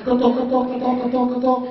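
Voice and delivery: rapped-out mock knocks; voiced door knocks; knocking sound. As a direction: tap out each knock with the voice.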